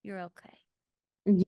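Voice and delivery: slow, gentle reassurance; soothing dog mom. Acted. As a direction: soothe a nervous pup. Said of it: "my dog mom voice".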